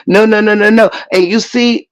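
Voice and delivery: usual speaking voice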